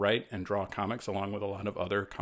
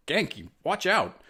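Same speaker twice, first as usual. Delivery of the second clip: slow, urgent call of warning, higher; shouted warning; 7 semitones higher, 4 dB louder, slower than usual. A narrator's reading. Miles warns his best friend Ganke, urgent shout. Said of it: "Miles shouted to his best friend".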